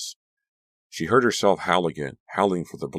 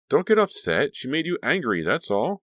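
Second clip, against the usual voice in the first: soothing; 10 semitones higher, 3 dB louder than usual. Acted. Caroline soothing Danielle.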